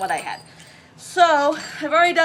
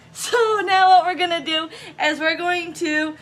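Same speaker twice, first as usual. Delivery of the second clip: laughing; 5 semitones higher, 4 dB louder than usual. Real, not acted. Laughing through instructions.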